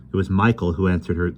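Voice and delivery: usual speaking voice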